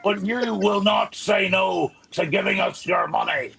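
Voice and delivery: high, pushy preacher voice; a hint of a thundering preacher. Mock preacher after Paisley suggestion.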